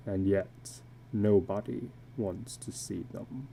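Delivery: low, slow, hushed and haunted; a weary man confessing what he can't wash off; illustrated man. Acted.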